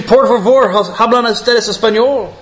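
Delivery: high-pitched, mildly frustrated plea in Spanish; a little frustrated. Acted. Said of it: "in a little bit of frustration".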